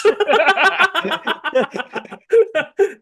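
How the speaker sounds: cackling laugh out loud; loud high laugh. Real, not acted. Big laugh at joke.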